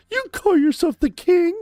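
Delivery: very high, sneering put-on voice; mocking heckler. A fan mocking LeBron James, very high and sneering. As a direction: squeal the jab like a taunting fan. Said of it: "And then someone says".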